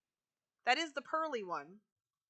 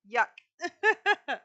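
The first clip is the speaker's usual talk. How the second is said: disgusted yuck; 7 semitones higher, 10 dB louder than usual. Real, not acted. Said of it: "ew, gross. Why is that my voice".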